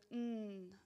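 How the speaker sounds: "n" as a sustained nasal hum; isolated phoneme. Shown as a demonstration. Teacher recalling a gesture already shown, "n" hummed long. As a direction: hold "n" as a sound, not a letter name. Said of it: "je l'avais montré aussi".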